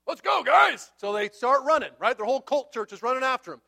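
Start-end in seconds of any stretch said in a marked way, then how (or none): 0.1-0.9 high, loud call to the group; rally the men for a chase; acted-out shout to follow. Micah rallies men for a chase.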